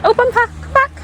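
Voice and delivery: high, loud call to the dog. Real, not acted. Owner calling her dog back on the walk.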